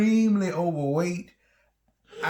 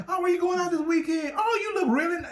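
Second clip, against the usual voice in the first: much higher, fast, chirpy chatter; clueless small talk; 13 semitones higher, faster than usual. Retold or imitated. Husband mimics his younger self chatting to a woman, higher and fast. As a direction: babble friendly questions obliviously.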